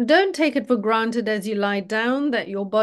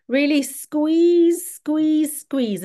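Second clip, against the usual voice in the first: drawn-out, higher-pitched coaching push; urging the squeeze; 4 semitones higher, 3 dB louder, much slower than usual. Acted. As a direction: stretch the words as if squeezing along. Urging listeners to tense muscles.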